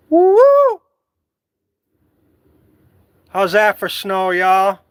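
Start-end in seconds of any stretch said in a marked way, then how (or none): 0.1-0.8 high, loud whoops of joy; whooping. Real, not acted. Vlogger whooping at the snowy yard, high and loud. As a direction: let out excited hoots. Hooting at the snow.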